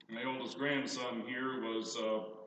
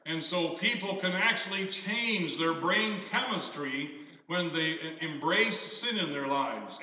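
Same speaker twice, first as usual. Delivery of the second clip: ordinary talk, nothing marked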